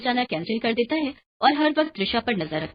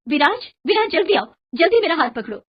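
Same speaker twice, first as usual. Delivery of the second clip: raised, higher-pitched urging; urgent calling; 6 semitones higher, 6 dB louder than usual. Acted. Wife at poolside reaches for her drowning husband, calling urgently. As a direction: call out to hurry someone to safety.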